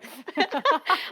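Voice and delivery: high, loud laugh; burst of laughter. Real, not acted. Reacting to the host's remark about her face, a loud laugh. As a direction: laugh out at the teasing.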